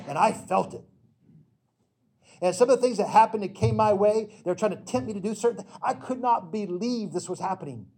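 loud, high, urgent preaching to the congregation; fervent preaching. Acted. Pastor preaching loudly and urgently to his congregation. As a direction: drive it home from the pulpit.

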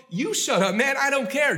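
high, defiant snap back; defiant retort. Acted. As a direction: throw it back at them, defiant. Beggar snaps back at crowd.

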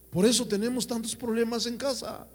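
ordinary delivery

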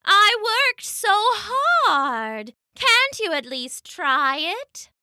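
high, very slow, hurt Mama voice; pleading Mama. A narrator's reading. Mama begs Papa to try her soup, pleading. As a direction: plead slowly, pitched well up.

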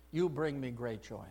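a quiet, tender echo of the Father; tender, hushed echo. Acted. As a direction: breathe it again gently, like a father to a child. Voicing God's words twice.

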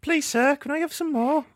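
high, pleading British urchin; mock British accent. Host joins the accent game as a begging orphan, high British. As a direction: beg in a put-on high British voice. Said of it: "my, my best British accent".

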